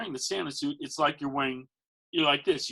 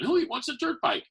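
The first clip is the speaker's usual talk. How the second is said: much higher, teasing question to the parents; playful Santa question; 14 semitones higher than usual. Retold or imitated. Santa asking parents who wants the bike, high and teasing. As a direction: toss it up to the parents with a wink.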